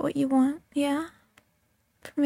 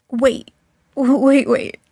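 louder startled stop; sudden startled wait; 8 dB louder than usual. Acted. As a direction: break off, suddenly alert. Sudden interruption in roleplay.

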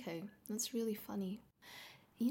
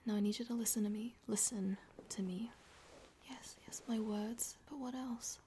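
soft insistent whisper; commanding whisper; 6 dB quieter than usual. Acted. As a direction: whisper orders close.

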